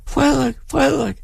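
put-on high shrieking girl calling. A narrator's reading. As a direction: scream his name. Girl screams again. (pige der skriger hans navn)

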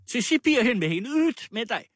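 high, loud screech; howling outrage. A narrator's reading. Landlady ordering the supposed thief out, howling. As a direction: shriek the banishment in fury. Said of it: "hylede fru Libeveksel".

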